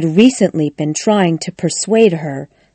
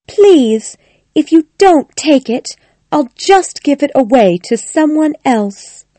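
Connie, insistent; 9 semitones higher than usual. Acted. Connie urging Missus Bolton to take the box, high insistent voice. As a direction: press the gift on her in a high voice. Character's line in narration.

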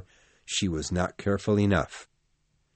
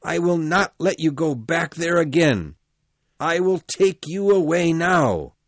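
heated insistence; 7 semitones higher, 5 dB louder than usual. Acted.

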